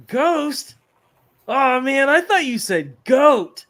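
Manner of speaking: high, loud dismay, slow at the end; student blurting out his mistake; shocked punchline. Retold or imitated. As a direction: realise the mix-up, horrified.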